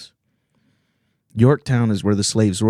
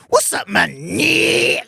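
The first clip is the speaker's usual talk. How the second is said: mock bro greeting; slower than usual.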